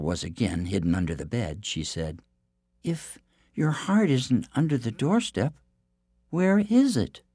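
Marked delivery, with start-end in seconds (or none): 2.8-7.2 high, sweet innocent princess; theme park princess. Acted. Princess asks giant.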